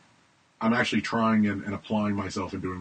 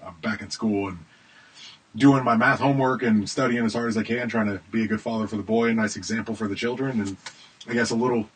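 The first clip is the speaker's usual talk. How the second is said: ordinary talk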